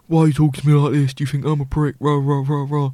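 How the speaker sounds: fast, mouthy imitation of an angry customer; quick sneering mock aggression; mouthy mock rant. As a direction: rattle it off like a gobby bloke picking a fight. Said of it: "like, really mouthy".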